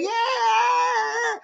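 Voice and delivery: high shouted cheer; mock crowd cheer. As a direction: yell like a jeering mob.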